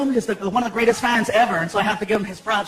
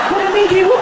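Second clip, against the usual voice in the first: put-on high loud worried kid; 9 semitones higher, 5 dB louder than usual. Son asking dad what happened, high kid voice. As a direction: ask it as a scared boy.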